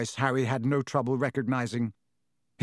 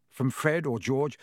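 usual speaking voice

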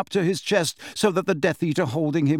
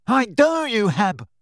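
higher, louder furious cry, stuffy-nosed; roared; 6 semitones higher, 3 dB louder than usual. A narrator's reading. Neville roaring at Bellatrix, higher and louder. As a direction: hurl the defiance. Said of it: "roared Neville".

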